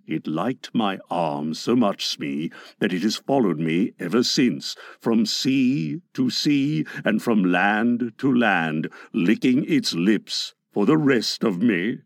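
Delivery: deep, confiding pirate captain. A narrator's reading. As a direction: drop low as if sharing a dread. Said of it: "He lowered his voice".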